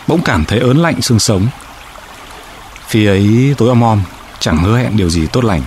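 ordinary delivery, nothing marked

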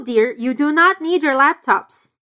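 normal talking voice

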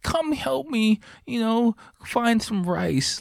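high, mock-needy man-child; begging for attention. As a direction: imitate a helpless kid pleading, high-pitched. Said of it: "just begging for attention".

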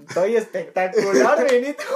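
loud, high, drawn-out and laughing; over-bright insistence. Real, not acted. Host assures concerned guest he is fine, loud and laughing. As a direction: insist loudly and slowly, breaking into a high laugh.

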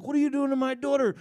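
high, loud protest at the doctor; outraged. Retold or imitated. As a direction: demand it like an angry father.

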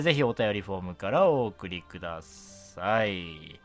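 ordinary talk

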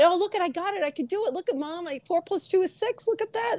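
put-on proud little kid. Child showing mom a solved sum, proud and eager.

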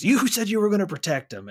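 high, loud accusing yell; a son yelling at his father in grief; retold angry outburst. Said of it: "that frustration boils over, and he says".

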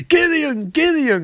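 high-pitched hammy call for help; mock urgent calling. Villain calling his computer, mock urgent. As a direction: call out twice, rising high in mock panic.

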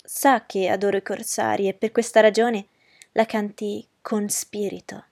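ordinary delivery, nothing marked

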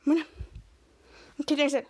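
usual speaking voice

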